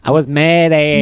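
high, loud mock-British princess; Princess Diana. Host escalating the princess joke, high loud accent. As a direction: push the royal impression high and loud. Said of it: "Princess Diana is like".

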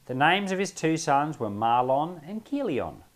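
measured, formal reading of scripture. Acted. Reading the book of Ruth to the group, measured scripture reading. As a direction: read the Bible passage aloud with care. Reads Ruth aloud before teaching.

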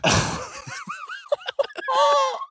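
shrieking laugh. Real, not acted. Host losing it at the cheeseburger joke, shrieking.

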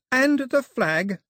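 put-on high, firm young woman's command; angry Daphne. A narrator's reading. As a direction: order the soldiers to disarm. Daphne demands the flag.

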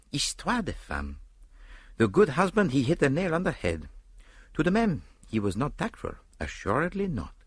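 put-on wry musing in a French accent; French-accented detective. A narrator's reading. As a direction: muse knowingly in a French accent. Story figure, Belgian detective musing.

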